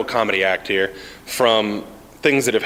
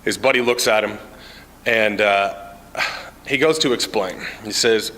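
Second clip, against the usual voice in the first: ordinary talk, nothing marked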